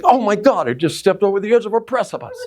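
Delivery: high, rushed alarm; panicked realization, tumbling out quickly; sudden panic. Retold or imitated. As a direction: blurt it in fast, dawning horror. Reliving the shock of stepping off.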